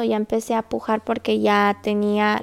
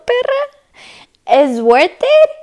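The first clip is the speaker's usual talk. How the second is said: high-pitched drawn-out squeal; exaggerated squeaky outcry; exclaiming; 11 semitones higher, 6 dB louder, much slower than usual. Real, not acted. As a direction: shoot the word up high and stretch it. Exclaims about the pain.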